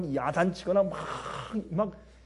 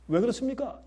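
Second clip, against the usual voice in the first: higher, stronger second asking; rising emphasis; 6 semitones higher, 3 dB quieter than usual. Shown as a demonstration. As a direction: lift the question higher. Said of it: "repeated question building".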